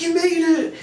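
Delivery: very high, celebratory mock scream; squealed retold congratulations; excited scream. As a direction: imitate cheering girls shrieking good news. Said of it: "they scream".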